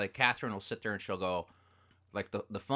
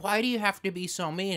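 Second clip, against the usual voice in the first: a higher mock-whiny wife; mock her hurt complaint; imitating wife; 12 semitones higher, 5 dB louder than usual. Wife scolding her husband, higher mock-whiny voice. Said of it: "she's just like".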